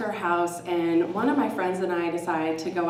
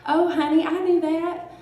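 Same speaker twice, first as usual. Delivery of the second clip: high, louder Southern lilt; Southern accent; 10 semitones higher, 5 dB louder than usual. Retold or imitated. Older friend answering the storyteller, in a Southern accent. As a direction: imitate a knowing Southern friend, pitched high. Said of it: "beautiful Southern accent".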